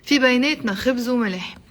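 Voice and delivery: fast, run-together Arabic phrase; quick natural pace. Shown as a demonstration. Teacher models the whole expression, quick and connected. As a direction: say it briskly as one linked phrase.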